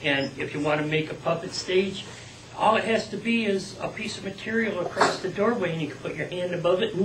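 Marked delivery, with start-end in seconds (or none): none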